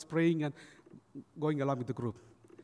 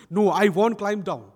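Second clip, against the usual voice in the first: put-on louder, stubborn little boy's refusal; adamant refusal; 3 semitones higher, 10 dB louder than usual. Naughty boy up a tree refusing his father, adamant. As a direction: dig your heels in loudly. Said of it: "this boy was so adamant".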